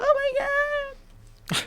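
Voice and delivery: very high, squeaky mock sob; drawn-out pretend crying squeal; imagined tearful mom. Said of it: "I hope she's crying right now".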